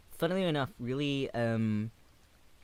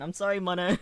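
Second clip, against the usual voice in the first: choked up; 7 semitones higher, 6 dB louder, faster than usual. Real, not acted. Touched by viewer's sad story.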